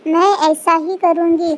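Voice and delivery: normal talking voice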